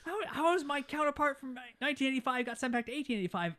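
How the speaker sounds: baffled disbelief at the date; bewildered Doc. Retold or imitated. Doc asking Marty in high disbelief how it happened. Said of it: "Doc's like".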